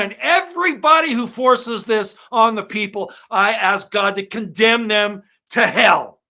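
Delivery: loud, high, righteous shout to heaven; roaring curse; furious condemnation. Real, not acted. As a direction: call down damnation in full fury. Calling on God to condemn.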